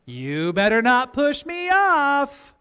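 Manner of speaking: slow warning climbing high in pitch; mock warning. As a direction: stretch out a playful threat, rising to the last word. Acts dad's playful warning.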